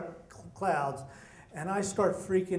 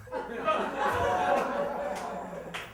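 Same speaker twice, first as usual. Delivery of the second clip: high breathless laugh; the storyteller cracks up, breathy and high; real laugh; 9 semitones higher than usual. Real, not acted. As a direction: let a real airy laugh out at the punchline. Laughing at the punchline.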